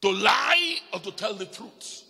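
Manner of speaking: loud, high preacher's shout; shouted question. Acted. Preacher challenging the congregation, shouting. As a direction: throw the question at them, loud.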